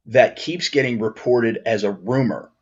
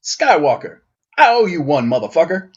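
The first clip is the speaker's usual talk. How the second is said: higher, louder movie-star impression; Samuel Jackson; 6 semitones higher, 5 dB louder than usual. Fan imagining the trailer's Mace Windu, actor impression. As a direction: play it loud and punchy, pitched up. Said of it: "you can just hear Samuel Jackson's voice going".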